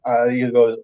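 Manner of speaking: normal talking voice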